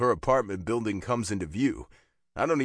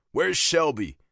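higher, forceful demand; harsh demand; 5 semitones higher, 4 dB louder than usual. A narrator's reading. Biker phoning his girlfriend's friend, demanding harshly. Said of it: "I demand harshly".

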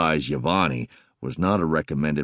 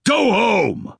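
loud bass yell to leave; angry shout; 7 dB louder than usual. Acted. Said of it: "putting some anger into my voice".

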